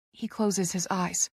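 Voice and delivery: ordinary delivery, nothing marked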